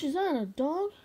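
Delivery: ordinary delivery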